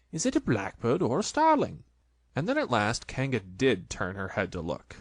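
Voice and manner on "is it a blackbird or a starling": fussy Rabbit, pitched higher; a lightly voiced busy Rabbit.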